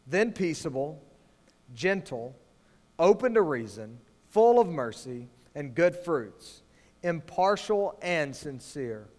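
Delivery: slow, hushed scripture reading; unhurried, reverent reading of a Bible verse. Acted. Reads scripture slowly.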